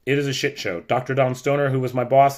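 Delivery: ordinary talk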